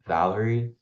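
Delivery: ordinary delivery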